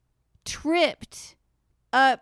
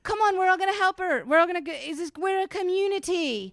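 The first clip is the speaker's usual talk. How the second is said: higher, fast, rallying the crowd; a lightly voiced, higher rallying call to the fans; rallying singer; 7 semitones higher, faster than usual. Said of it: "She's like".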